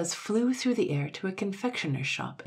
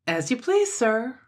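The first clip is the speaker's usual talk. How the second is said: high, loud, obliging reply; cheerily deferential; cheerful; 8 semitones higher, 7 dB louder than usual. A narrator's reading. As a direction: chirp it agreeably to a customer. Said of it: "replied the man cheerfully".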